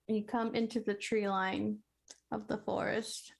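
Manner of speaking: ordinary talk, nothing marked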